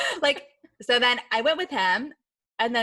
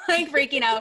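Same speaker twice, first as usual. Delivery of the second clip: laughing excitedly; 6 semitones higher, 6 dB louder than usual. Real, not acted. Reliving the terrifying jump.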